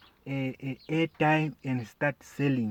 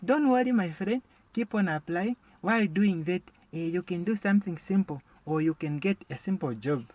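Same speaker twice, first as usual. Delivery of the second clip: higher, sing-song fake concern; mock sympathetic friend; 4 semitones higher than usual. Comedian mimics a pitying friend offering hollow advice, sing-song. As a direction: play the pitying friend, voice lifted high. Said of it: "they say, eh".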